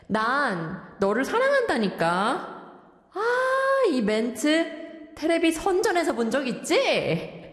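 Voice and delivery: lovelorn lyric reading with corny, hammed-up swoops; old-fashioned 늘크크 teacher. Radio host as 늘크크 reads a love confession, hammed-up old-timer. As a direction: play a dated corny character professing love. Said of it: "늘크크 선생님 joining us in the studio reading out".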